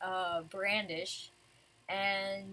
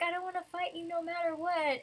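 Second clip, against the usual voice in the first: high imitated plea; mock pleading; 8 semitones higher than usual. Lucy refuses to fight Brandish, pleading. Lucy's plea voiced a second time.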